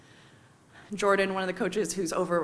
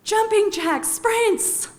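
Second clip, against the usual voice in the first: loud, much higher drill shout; coach yelling; 13 semitones higher, 7 dB louder, much slower than usual. Retold or imitated. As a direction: bark it out like a fitness coach. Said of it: "Arturo yells".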